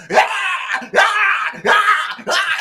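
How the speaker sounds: very high, loud repeated screaming ah; possessed screams. Retold or imitated. Host imitating a possessed woman screaming. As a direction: shriek like a demon strapped to a bed. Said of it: "her just go".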